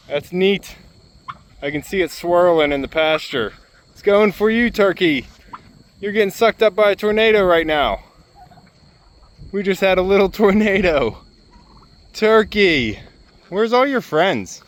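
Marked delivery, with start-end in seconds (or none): 3.9-14.6 high, lively ribbing aimed at the turkey; pitched-up playful animal talk; excited teasing of the turkey. Real, not acted. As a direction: tease the bird with a grin. Teasing turkey during dust devil.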